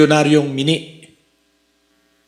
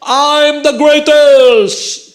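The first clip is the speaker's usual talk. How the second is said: drawn-out, much higher, louder boast; boastful shout; 9 semitones higher, 5 dB louder, much slower than usual. Retold or imitated. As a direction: shout it slowly like a champion crowing. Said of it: "sumisigaw nga yung person". (mayabang na sigaw, mabagal at mataas)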